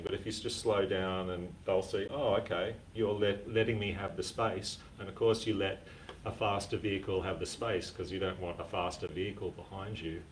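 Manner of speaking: ordinary talk, nothing marked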